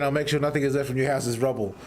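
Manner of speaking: ordinary talk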